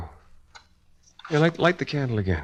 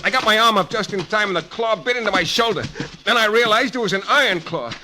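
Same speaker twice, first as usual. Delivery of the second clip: higher and higher, pressing on; frantic fight narration; 10 semitones higher than usual. Acted. Shane recounting the fight with the monster, frantic and rising. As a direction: tell the struggle breathless and rising.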